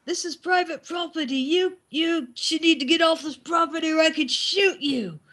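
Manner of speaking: high mock angry farmer; a lightly voiced angry farmer warning; a hint of a gruff farmer ordering her off; imitated farmer yelling. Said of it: "Yells at my daughter".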